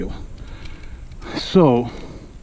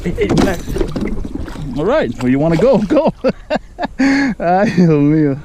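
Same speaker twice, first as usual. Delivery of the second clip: laughing excitement; 5 semitones higher, 3 dB louder than usual. Real, not acted. Fish flopping in hands.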